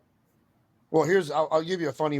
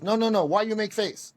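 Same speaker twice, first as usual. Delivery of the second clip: raised-pitch imitation of the sensei's broken English; Sensei impression; 6 semitones higher than usual. Sensei asks student Mark why he made a face, imitated in broken English. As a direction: play the puzzled teacher questioning a student. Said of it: "if I impersonate Sensei".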